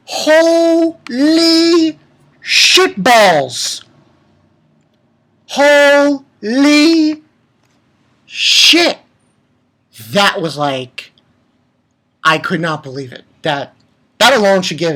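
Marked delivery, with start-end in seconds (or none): 0.1-9.0 very drawn-out, stunned swearing; exaggerated amazement. Reviewer reacting to the twist, stretched-out astonishment. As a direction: stretch out "Holy", pausing before the swear.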